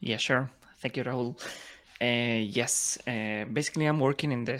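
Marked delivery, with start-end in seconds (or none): none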